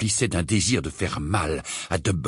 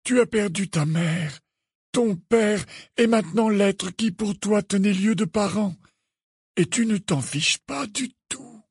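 put-on high, gentle old man's voice, slowing at the end; grave, patient, even delivery; utterly calm; 10 semitones higher than usual. A narrator's reading. Dumbledore listing Harry's losses, utterly calm. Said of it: "plus calme que jamais".

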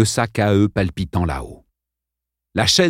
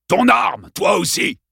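barked order. Acted. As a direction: snap the command out. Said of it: "aboya-t-il".

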